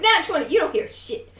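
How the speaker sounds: imagine the player gloating, laughing. Retold or imitated. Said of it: "I'm waiting for it to be like".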